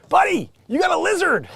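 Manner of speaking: high, loud comic alarm; pitched-way-up yell of warning; mock warning shout. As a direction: call out a pretend urgent warning. Calling out a warning to man.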